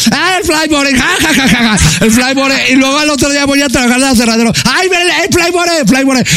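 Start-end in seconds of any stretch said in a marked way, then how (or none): 0.1-2.7 higher jeer with spoken fake laughter; mocking. Townsfolk laugh at his new nickname, mocking imitation. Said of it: "para burlarse de mí". 4.6-6.3 high-pitched teasing imitation; mocking. Coworkers spot him at the sawmill, mocking imitation. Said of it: "me empezaron a hablar para burlarse de mí".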